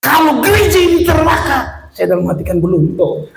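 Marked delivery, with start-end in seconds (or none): 0.0-1.9 angry shout. Retold or imitated. Pastor's wife scolds him over the burnt pot, shouting high. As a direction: yell at him in fury. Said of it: "dia bilang".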